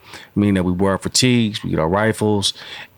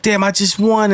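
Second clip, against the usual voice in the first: high-pitched retold complaint; whining protest; 12 semitones higher than usual. Young player bitching to himself after winning, whining. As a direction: gripe in a high, aggrieved voice. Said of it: "I'm crying, I'm bitching about it".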